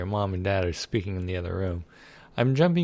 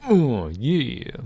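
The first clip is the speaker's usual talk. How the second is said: higher, louder gloating; gleeful; 4 semitones higher, 6 dB louder than usual. Real, not acted. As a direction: savor the win. Guilt trick works.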